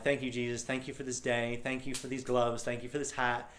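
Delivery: soft, hushed thanks retold; grateful prayer. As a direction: murmur a private thank-you prayer. Retells his grateful prayer to Jesus.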